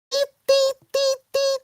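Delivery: very high electronic beeping; imitated microwave beeps. Diner imitating the kitchen microwave, high piercing beeps. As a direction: mimic a microwave timer, evenly spaced high beeps. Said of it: "the worst sound you could ever hear".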